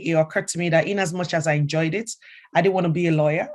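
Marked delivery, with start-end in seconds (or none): none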